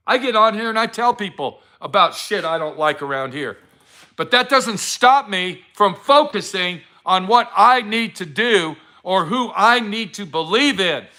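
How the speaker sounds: normal talking voice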